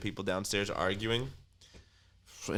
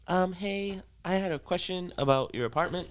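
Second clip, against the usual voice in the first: higher sweet phone voice; polite landlady; 9 semitones higher than usual. Retold or imitated. Apartment office calling about a complaint, higher polite voice. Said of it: "she goes".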